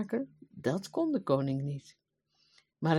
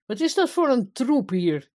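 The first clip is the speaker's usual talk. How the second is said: loud, raised-pitch scolding; threatening; 7 semitones higher, 7 dB louder than usual. A narrator's reading. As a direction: demand answers like an angry king. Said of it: "riep hij dreigend". (dreigend, luid scheldend)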